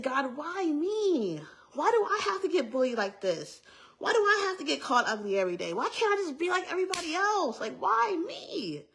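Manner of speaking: high, whiny wail, retold; anguished plea. Bullied girl questioning God, anguished plea. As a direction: plead upward, stretching the key words.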